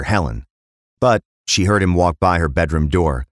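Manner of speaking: ordinary delivery, nothing marked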